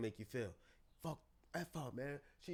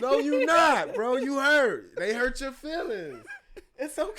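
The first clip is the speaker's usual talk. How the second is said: higher and louder, pressing the point; emphatic outburst; 9 semitones higher, 9 dB louder, slower than usual. Acted. Therapist calling out a hurting brother, loud and insistent. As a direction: call him out, then soften.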